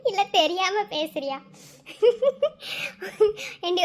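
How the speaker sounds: ordinary talk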